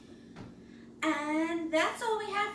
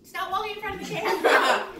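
laughing; 6 semitones higher, 9 dB louder, faster than usual. Real, not acted. Scolding while laughing.

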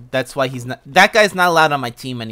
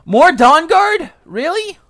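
much higher, slow and louder; incredulous groan at more enemies; exasperated disbelief; 9 dB louder, much slower than usual. Real, not acted. Gamer facing yet more Dawnguard, exasperated disbelief.

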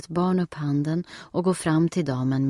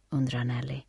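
plain reading voice

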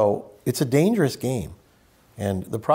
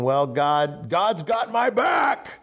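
mock cocky believer; 5 semitones higher, slower than usual. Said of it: "because you're thinking".

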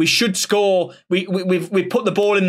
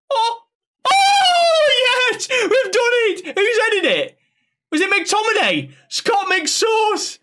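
much higher, screaming with joy; ecstatic goal scream; 13 semitones higher, slower than usual. Real, not acted. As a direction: lose it completely as the goal goes in. Fan watching winning goal.

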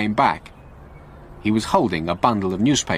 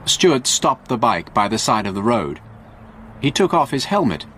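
usual speaking voice

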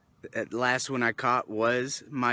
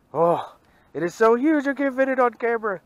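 high, louder, fast gush of wonder; real excitement; 12 semitones higher, 5 dB louder than usual. Real, not acted. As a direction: rave at how huge it is. Marvelling at fish size.

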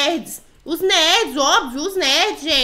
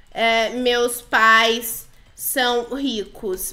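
"meus pais são ricos" drawn out slowly, word by word; slow dictation; 3 semitones lower, much slower than usual. Pretend. Player types her claim "meus pais são ricos" to the girls, slowly dictating. Escreve no chat, falando devagar.

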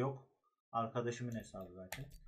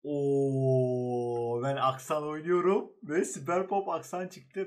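excited; 3 semitones higher, 7 dB louder, slower than usual. Real, not acted. Gets a skin for his main.

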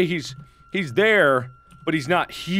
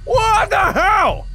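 exasperated yelling; 13 semitones higher than usual. Real, not acted. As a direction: yell in exasperation at a pest that won't leave. Ranting at a game entity following him.

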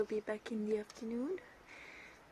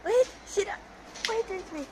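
higher, louder pleading; excited; 9 semitones higher, 5 dB louder, faster than usual. Real, not acted. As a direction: fend them off, high. Dogs jumping, she's overwhelmed.

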